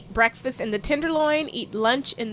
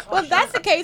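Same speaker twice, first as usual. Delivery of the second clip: laughing; 7 semitones higher, 6 dB louder than usual. Real, not acted. Teasing comeback while laughing.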